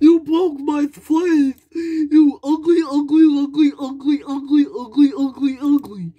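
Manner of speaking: high, loud crying yell; high pretend tantrum scream; screaming toy insult. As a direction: shriek the insults through tears. Toy screams insults after hurt.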